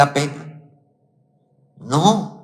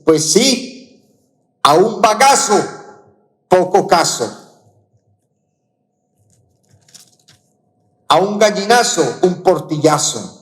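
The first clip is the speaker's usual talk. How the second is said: mocking, a touch higher, half-acted; sarcastic retort; 3 semitones higher than usual. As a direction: throw the saying back with a sneer. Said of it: "en cierto tono sarcástico le dice". (tono sarcástico y burlón)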